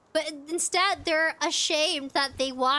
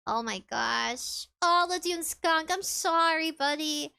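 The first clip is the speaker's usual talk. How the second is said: ordinary talk, nothing marked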